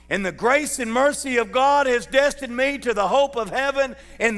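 high, loud, slow preacher's cry; full-force preaching. Acted. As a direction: preach it out to the rafters, drawn long.

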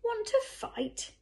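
very high, drawn-out dare; cocky challenge, pitched right up and slow; bad-tempered challenge. A narrator's reading. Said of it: "said the bad-tempered ladybird".

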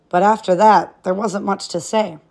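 ordinary delivery

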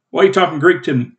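usual speaking voice

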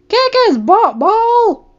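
cheering shout. Acted. Cheering at the TV fight.

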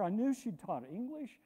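usual speaking voice

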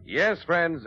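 normal talking voice

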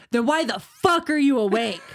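loud, indignant retold demand; irritated outburst. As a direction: snap it at whoever woke you, loudly. Acted cross Sam woken up.

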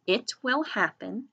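careful teacher dictation, pausing between words; dictating a sentence. Shown as a demonstration. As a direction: dictate it to young writers word by word. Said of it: "So try that sentence".

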